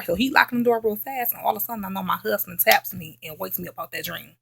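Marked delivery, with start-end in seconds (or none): none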